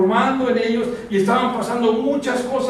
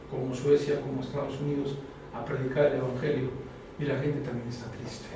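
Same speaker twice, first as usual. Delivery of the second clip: lower, much softer confiding preacher's voice; hushed, earnest pulpit talk; intimate sermon; 7 semitones lower, 10 dB quieter than usual. Acted. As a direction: lean in and confide it to the congregation. Intimate low preaching passage.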